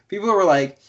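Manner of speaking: ordinary talk, nothing marked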